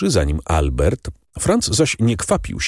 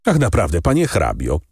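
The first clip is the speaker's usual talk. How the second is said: usual speaking voice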